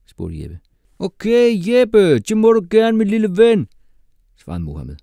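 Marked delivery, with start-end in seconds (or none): 1.0-3.7 very high, very loud, cheeky reply; loud mock permission. A narrator's reading. Pupil granting teacher permission, loud and cheekily patronising.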